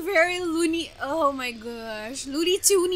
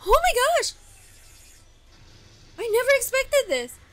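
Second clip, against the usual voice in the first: much higher, fast amazed outburst; astonished; 9 semitones higher, 4 dB louder, much faster than usual. Real, not acted. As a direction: blown away by the surprise. Shocked by brush strokes.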